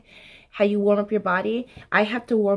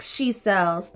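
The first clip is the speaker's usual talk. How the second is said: slow careful start. Shown as a demonstration.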